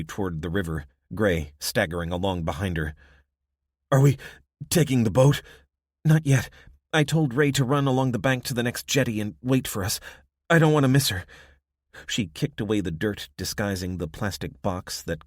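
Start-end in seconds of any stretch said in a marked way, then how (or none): 3.9-5.4 wounded man voice. Acted. Gray's voice, breathless. 6.0-11.3 high, loud urgent woman. Acted. Abby's voice, urgent.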